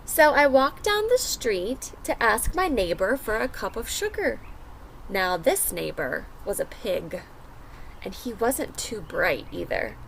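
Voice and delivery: put-on lively first-person wolf narrator for kids; storybook read-aloud. A narrator's reading. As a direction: tell it as the wolf, reading to a class. Reads the wolf's story aloud.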